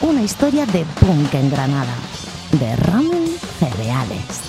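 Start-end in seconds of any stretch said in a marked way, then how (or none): none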